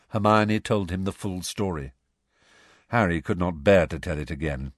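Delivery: plain reading voice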